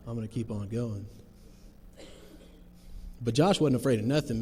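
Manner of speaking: normal talking voice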